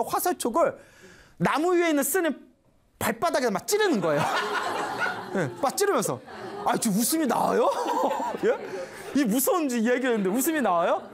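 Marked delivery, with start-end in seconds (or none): 4.3-5.3 high, breathless burst of laughter; real laugh breaking through. Real, not acted. Storyteller laughing at her own grim tale, breathy laugh. 7.8-8.4 laugh. Real, not acted. Teller laughs at audience.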